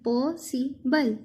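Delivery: Possible slowly, short gaps between "पॉ", "सि", "बल"; three slow close-set beats on possible; split into three. Shown as a demonstration. Teacher pointing out three parts, possible slow and split. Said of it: "इसके हमने तीन हिस्से".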